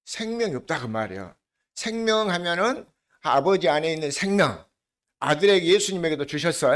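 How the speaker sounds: normal talking voice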